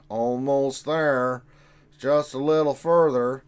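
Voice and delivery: slow, stretched, hammed-up pilot line; drawn-out movie line. Streamer to chat, hamming up a slow movie line. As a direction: drag each word out like a tense trench run.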